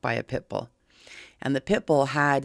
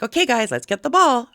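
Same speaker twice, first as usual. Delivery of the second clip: much higher, louder cheerful invitation; happy excited call; 9 semitones higher, 7 dB louder than usual. Retold or imitated. Owner luring the dogs apart with a ball, happy excited call. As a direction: sound like it's fetch time. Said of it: "I stayed nice and calm. I, I kept it excited, happy".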